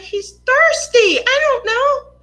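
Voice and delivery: mock innocent child; innocent kid voice. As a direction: shrug it off like a guilty kid. Kid self defending to dad.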